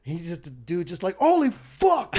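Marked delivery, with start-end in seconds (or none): 1.2-2.2 drawn-out, high, loud British exclamation; mock British accent. Storyteller quoting the appalled cleaner, mock British shout. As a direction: cry out slowly as a shocked Brit. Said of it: "Like, in a British way".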